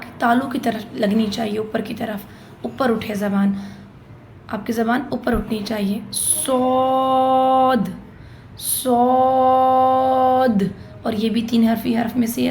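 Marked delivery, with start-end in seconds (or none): 6.1-10.6 صاد thick and heavy, drawn out slowly; heavy drawn saad. Shown as a demonstration. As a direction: hold صاد long with the tongue raised. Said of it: "کھینچ کے پڑھنا ہے". (صاد بھاری، کھینچ کر)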